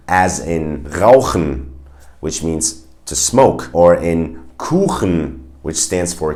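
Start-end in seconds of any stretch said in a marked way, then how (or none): 0.8-1.7 RAUCHEN with a throaty, scraped ch; slow German RAUCHEN, ch from the throat; hard German ch. Shown as a demonstration. Teacher gives an example word, hard German ch. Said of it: "the hard one would be ch". 4.6-5.4 KUCHEN with a throaty, scraped ch; slow German KUCHEN, ch from the throat; hard German ch. Shown as a demonstration. Teacher gives the word for cake, hard German ch. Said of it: "it's a little bit harder".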